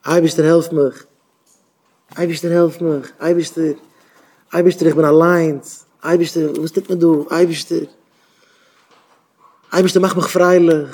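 short, separate calls to God with falling endings; pleading prayer. Shown as a demonstration. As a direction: call out to God simply and plead. Demonstrates talking simply to God. (בעטנדיגע תפילה צום אייבערשטן)

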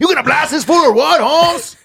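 hammed-up homeboy hype. Host hypes guest, street persona.

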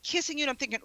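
ordinary delivery

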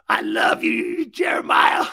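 loud, high, helpless laughter through the words; real laughing exclamation. Real, not acted. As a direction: squeeze it out while cracking up. Cracking up at a caller's joke.

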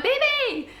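very high, loud cry; shrill retold yelp. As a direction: yelp it at him in alarm. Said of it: "and I went".

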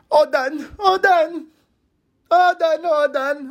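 put-on very high, overdramatic little-kid cry; dramatic toddler daughter. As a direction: wail it in a tiny toddler's voice. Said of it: "All of this is very dramatic".

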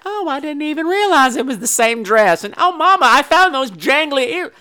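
high, innocent daughter voice; put-on sugary daughter; sweet daughter. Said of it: "she's just so sweet and everything".

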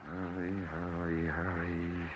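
hushed, drawn-out urging to nobody; impatient mutter. Acted. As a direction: mutter it to yourself, impatient for the line to connect. Waiting anxiously on the phone line.

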